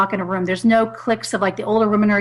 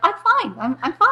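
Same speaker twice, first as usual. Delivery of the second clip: putting on composure; 8 semitones higher than usual. Retold or imitated. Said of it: "you're trying to be like".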